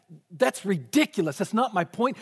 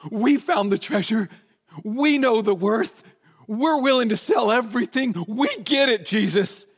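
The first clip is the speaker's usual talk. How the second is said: higher, louder confident boast; eager proud claim; 5 semitones higher, 5 dB louder than usual. Retold or imitated. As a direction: announce it proudly, pushing it up. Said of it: "The focus is not, is not on".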